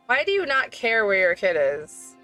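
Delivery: ordinary talk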